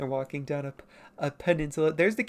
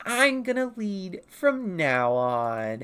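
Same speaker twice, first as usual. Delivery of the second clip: slow, higher declaration with drawn-out words; Penta; 4 semitones higher, 3 dB louder, much slower than usual. Acted. Dinosaur arguing over who leads, insistent character voice. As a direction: announce it insistently, stretching the vowels. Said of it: "And Penta's like".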